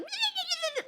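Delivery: very high mock bird chirping; high-pitched wordless bird squeal; little bird chatter. Shown as a demonstration. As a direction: imitate upset women as twittering little birds. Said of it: "we're gonna sound like little birds".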